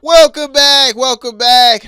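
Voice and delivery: loud, high hype welcome back; big announcer welcome. Acted. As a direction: belt it like a show host. Host returning from break, shouting.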